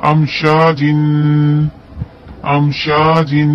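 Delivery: अमशाजिन with a clear, unnasalised म; slow recitation of अमशाजिन; clear meem. Shown as a demonstration. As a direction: say अमशाजिन slowly, म crisp. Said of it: "इजहार होगा".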